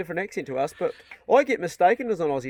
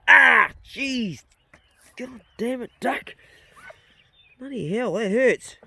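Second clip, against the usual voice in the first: higher, sharp cry then cursing; pained cursing; 5 semitones higher, slower than usual. Real, not acted. Man cursing the duck that hurt him, pained. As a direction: curse through the sting of a bite.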